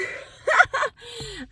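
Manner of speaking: high, loud burst of laughter; sudden high-pitched laugh. Real, not acted. Vlogger amused by her own greeting, laughing loudly.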